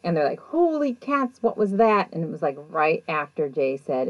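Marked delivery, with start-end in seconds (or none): 0.4-2.1 high-pitched mock alarm, drawn out then quicker; startled. Investigators react to a huge sound from the kitchen, startled. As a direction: imitate a startled exclamation, pitched well up.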